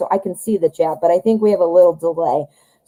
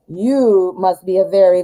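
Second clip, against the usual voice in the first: ordinary talk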